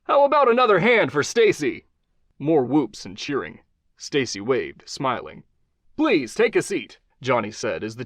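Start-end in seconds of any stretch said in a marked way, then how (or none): 0.0-1.9 much higher, louder call to the audience; host rallying crowd. Acted. 6.0-7.0 higher, upbeat host voice; talk-show host Johnny. Acted. Host invites guest.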